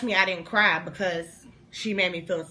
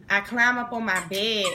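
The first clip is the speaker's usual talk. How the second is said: plain reading voice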